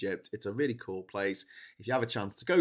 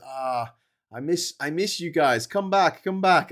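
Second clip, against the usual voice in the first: a lightly voiced old man calling come back; old man in Aswan begging the British to return; pleading old man; 6 semitones higher, 7 dB louder, slower than usual. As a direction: call come back louder, higher. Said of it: "And he goes".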